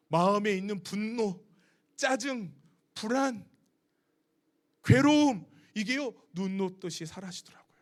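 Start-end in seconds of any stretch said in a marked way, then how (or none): none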